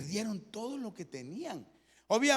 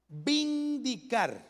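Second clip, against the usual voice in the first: "vindicar" drawn out slow and higher; stress on vindicar; 5 semitones higher, much slower than usual. Shown as a demonstration. Pastor to congregation, marking the key word: slow "vindicar". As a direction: stretch "vindicar" out, each syllable leaned on. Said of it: "le remarco la palabra vindicar".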